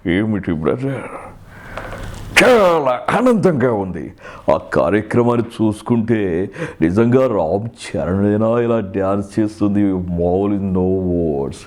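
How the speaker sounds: slow, drawn-out character voice; mimicry గాత్రం. Acted. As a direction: gush slowly in a put-on, stretched-out voice. Impression of someone praising a show. (సాగదీసిన mimicry గొంతు)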